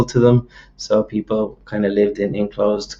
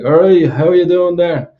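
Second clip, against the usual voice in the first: higher, louder inner-city Dublin greeting; inner-city Dublin accent; 7 semitones higher, 5 dB louder than usual. Shown as a demonstration. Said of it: "inner city Dublin accent".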